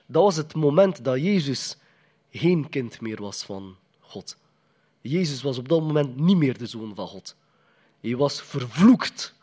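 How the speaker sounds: usual speaking voice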